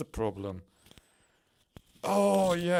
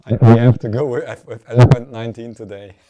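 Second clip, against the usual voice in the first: excited and loud; 16 dB louder, faster than usual. Real, not acted. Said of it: "maybe I'm so excited, so I'm loud right now".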